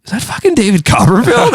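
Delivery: high-pitched starstruck disbelief; imitated incredulous driver. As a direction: act out a driver who can't believe it. Driver stunned by the celebrity.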